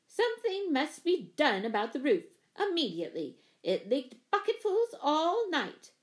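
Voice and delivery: emphatic, demanding complaint with swooping pitch; indignant tenant laying down the law. A narrator's reading. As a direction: demand action, stressing the grievance. Pennypacker interrupts with complaint.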